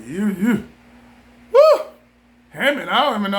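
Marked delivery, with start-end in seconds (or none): 1.5-1.8 high loud woo; excited whoop. Real, not acted. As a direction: let out a sudden whoop. Excited whoop mid-rant.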